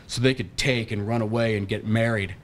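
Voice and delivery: ordinary delivery, nothing marked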